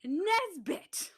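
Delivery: high, slow, grand announcement; Buzz as Mrs Nesbit. Retold or imitated. Quoting Buzz Lightyear at the tea party, high and slow. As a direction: declare it with mad dignity.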